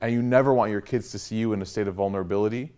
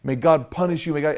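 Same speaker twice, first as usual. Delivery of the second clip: ordinary talk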